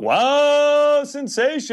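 high-pitched thrilled shout; excited. Real, not acted. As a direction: squeal with delight at the pull. Big card pulled from pack.

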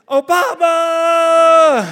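long, high, loud joyful yell; drawn-out celebratory shout; screaming Obama. Retold or imitated. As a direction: scream the name across the street.

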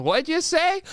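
put-on high, rising old man's query; hard-of-hearing grandpa. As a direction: ask high and puzzled, as if you missed it. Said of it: "Vernon can't hear very well".

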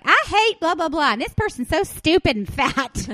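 high, loud, laughing impression of mom ranting; mocking mom rant. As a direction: ham up mom's rant through laughter. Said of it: "My mother will be like".

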